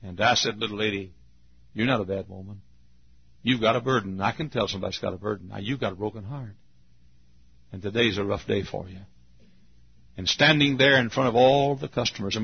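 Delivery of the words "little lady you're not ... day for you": gentle comforting. Retold or imitated. Tender words to a weeping woman.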